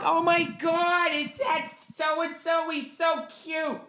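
mock teen fan gushing over a pop star; swooning boy band fan. Mocking panicked people as fans swooning over a boy band. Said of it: "This boy band level of freaking out".

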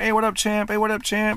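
high, punchy mock greeting; gym buddies' hail. Gym mates hailing him, imitated.

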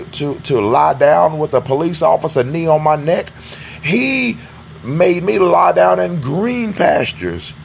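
loud, high building sermon cry; preaching at full force. Acted. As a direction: preach it out to the congregation.

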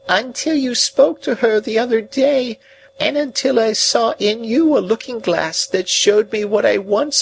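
confess, then break into a grieving wail; remorseful old lady. Acted. Old woman confessing to the young man, high grieving lament.

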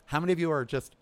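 coughing from smoky air; throat catching on smoke. Real, not acted. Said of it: "I have smoke in my throat".

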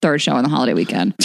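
usual speaking voice

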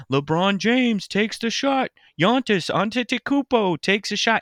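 imitating his son's high-pitched basketball play-by-play; excited kid commentator. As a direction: call the shot like a boy playing announcer. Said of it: "he was, like, going off".

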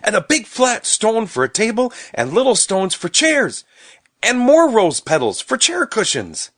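put-on very high, loud, eager child voice; excited. A narrator's reading. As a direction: pile up ideas with mounting excitement. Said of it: "said Stubby excitedly".

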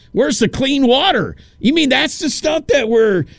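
acted-out indignant astonishment; shocked disbelief. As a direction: demand answers in appalled amazement. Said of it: "That's how I felt".

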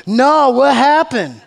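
slow, high, feigned-innocent question with a laugh; playing dumb. Jesus toying with the grieving disciples, feigned innocence with a laugh. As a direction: tease them by pretending not to know. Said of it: "Jesus goes".

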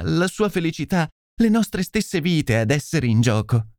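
anguished pleading lady. Acted. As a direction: plead as a devoted wife in trouble. (signora angosciata che implora)

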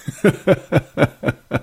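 amused chuckle, a little high; real laugh. Real, not acted. Preacher laughing at the hysteria he foresees, real laugh. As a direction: laugh at what you can picture. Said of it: "I'm laughing because I can see it".

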